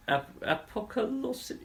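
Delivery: apocolosyn- attempted slowly, high and soft, breaking off; stumbling pronunciation. Shown as a demonstration. Co-host trying out a mouthful, apocolosyn- haltingly. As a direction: stumble softly into apocolosyn- and give up. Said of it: "it's deliberately a mouthful".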